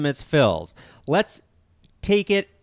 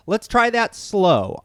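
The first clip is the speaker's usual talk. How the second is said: ordinary talk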